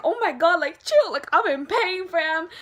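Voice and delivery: exasperated, hurting plea to back off; pained protest. Retold or imitated. Retold complaint while dragged, in pain.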